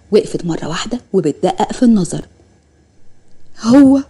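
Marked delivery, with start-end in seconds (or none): 3.6-4.0 startled gasp. Acted. Sister spots brother far off.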